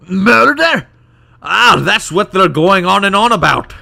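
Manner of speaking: high, loud dwarf in unsteady Scottish; wandering Scottish accent. Acted. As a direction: exclaim in a badly held Scottish brogue. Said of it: "This accent's gonna go all over the place".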